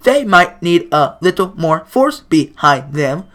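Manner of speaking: measured, careful advice; slow gentle club president. Acted. Monika explains slowly.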